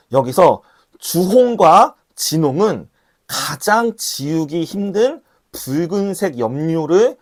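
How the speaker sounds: slow, deliberate, each word laid down; slow preacher's emphasis; slow and weighty. Shown as a demonstration. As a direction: spell it out slowly so it sinks in. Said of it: "emphatic first reading".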